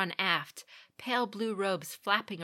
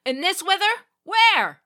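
loud, high disbelieving call; captain calling back; 13 semitones higher, 13 dB louder than usual. A narrator's reading. Captain calling back to the lookout in alarm. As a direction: shout the question across the ship. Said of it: "he called back".

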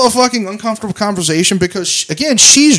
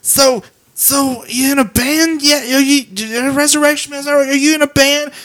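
mock drunk woman; 8 semitones higher than usual. Imitating the drunk woman quizzing them about a band. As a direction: pester like a drunk stranger. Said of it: "she was also like".

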